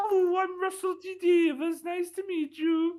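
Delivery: very high, badly faked Welsh accent; Russell T Davies. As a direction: squeak out a slow, hammed-up Welsh greeting. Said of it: "you sound a little bit more like Stephen Powell".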